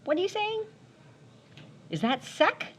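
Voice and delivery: high baffled squeak; mock puzzled high voice; mock baffled teacher. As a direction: play a confused listener, pitched high. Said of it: "and I'm like".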